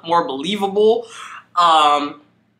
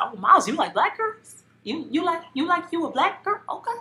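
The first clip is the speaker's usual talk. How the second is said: high, soft teasing sass; 12 semitones higher, 7 dB quieter, faster than usual. Retold or imitated. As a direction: tease him playfully. Teasing Miles.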